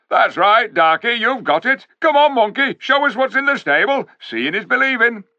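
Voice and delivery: high, loud taunting dwarf; jeering dwarf. A narrator's reading. Dwarf goading the ape to show the stable, loud and jeering. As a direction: goad the monkey mockingly.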